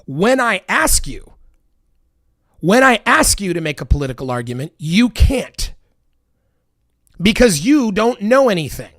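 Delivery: escalating furious shout. Real, not acted. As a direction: build to an all-out yell. Rant peaks.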